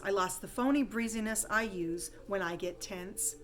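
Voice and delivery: usual speaking voice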